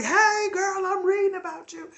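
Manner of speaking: high, loud gushing hello; excited greeting. Retold or imitated. Fan greeting a life coach she just discovered, excited. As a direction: greet her like a long-lost friend. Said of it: "And I'm like".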